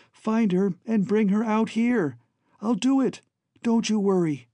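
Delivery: put-on high, resolute young voice; determined young Alan. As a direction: promise it with eager resolve. Voices Alan setting out on rescue.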